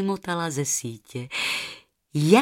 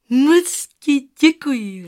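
put-on higher, slow, louder girl voice; girl thanking; 6 semitones higher, 8 dB louder, slower than usual. Rozárka thanks the goose, higher slow girl voice. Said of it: "řekla a usmála se".

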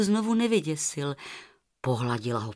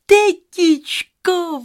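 much higher, slow, stretched-out call; long drawn call; 10 semitones higher, 10 dB louder, much slower than usual. Acted. Rozárka calls her aunt again, long stretched high call. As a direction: draw the name out long.

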